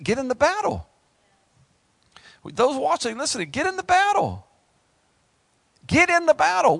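high, rising shout of exhortation; rally them into the fight; fiery call to battle; preaching at full force. Acted.

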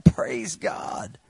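unvoiced whisper of praise; a hushed, private praise. Shown as a demonstration. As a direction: breathe it out in a whisper. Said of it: "your heart is shouting".

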